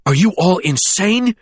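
high, disbelieving challenge; incredulous demand. A narrator's reading. James rounds on the others, incredulous demand. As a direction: demand an answer from all of them. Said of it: "James demanded".

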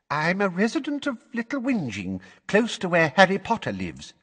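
high, quavery witness voice; Missus Figg. Acted. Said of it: "in her quavery voice".

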